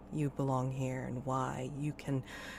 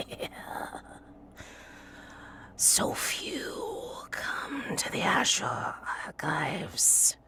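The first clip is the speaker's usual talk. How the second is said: slow whispered high brittle rasp; broken brittle voice; 6 semitones higher, much slower than usual. Acted. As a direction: breathe it out, old and cracking. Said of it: "in a broken and brittle voice".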